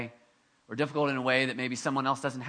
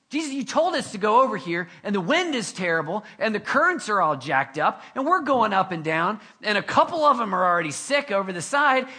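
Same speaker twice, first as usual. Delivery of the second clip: loud, higher-pitched imagined grumbling; mock complaining; 4 semitones higher, 5 dB louder than usual. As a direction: pile up the gripes, hammed-up. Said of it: "they didn't start complaining".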